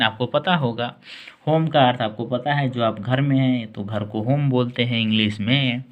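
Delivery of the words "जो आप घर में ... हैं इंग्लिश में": ordinary delivery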